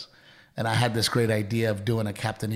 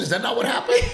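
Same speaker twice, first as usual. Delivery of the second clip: laughing shout; 4 dB louder, faster than usual. Real, not acted. Playful argument, laughing challenge.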